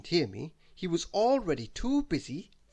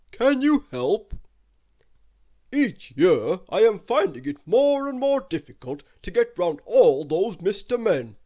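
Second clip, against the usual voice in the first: put-on loud, hearty old uncle, pitched up; Father Christmas reading his letter; 10 dB louder than usual. Uncle writing to his nephew for Christmas help, hearty loud voice. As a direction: boom out a cheery letter from the North Pole.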